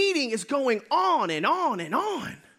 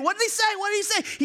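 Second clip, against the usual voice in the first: high, eager repeated question; excited pestering; 9 semitones higher than usual. Retold or imitated. Disciples pressing Philip, high and eager. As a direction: crowd him, dying to know.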